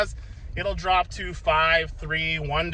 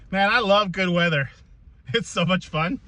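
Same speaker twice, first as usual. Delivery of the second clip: usual speaking voice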